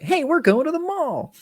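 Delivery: very high, chirpy sustained call; hammed-up movie line. Imitating the guy announcing the mall trip, hammed-up high chirp. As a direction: imitate him in a silly high, over-eager voice. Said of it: "the way he said it was like".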